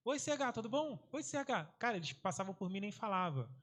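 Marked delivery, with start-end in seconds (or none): 0.1-1.7 put-on higher, sing-song kid greetings; greeting pupils. Teacher imitating students greeting him on the ramp, higher. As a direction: call hello like cheerful students. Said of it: "todos os alunos falando assim".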